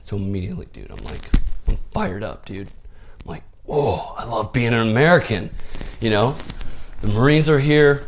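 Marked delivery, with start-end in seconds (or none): 3.6-8.1 put-on thrilled kid. Kid thrilled Marines came.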